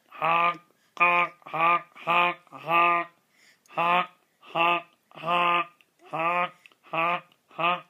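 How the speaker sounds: loud playful clown honks; silly clown. Pretend. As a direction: honk like a goofy clown horn. Dad making silly honks for baby.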